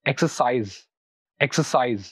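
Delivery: "exercise" stressed on CISE; last-syllable stress. Shown as a demonstration. Teacher models verbs for learners, "exercise" stressed on the last syllable. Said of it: "stress होता है वो last syllable".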